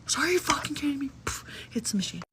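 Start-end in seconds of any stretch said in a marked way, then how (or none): 0.1-1.1 mock angry outburst. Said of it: "He gets mad and hits the machine".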